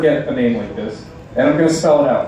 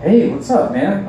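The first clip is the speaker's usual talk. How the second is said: higher, upbeat salesman greeting; overly nice salesman; 6 semitones higher, slower than usual. Retold or imitated. Salesman greeting a shopper, with upbeat pitch. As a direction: greet him with fake cheer. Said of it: "they're like".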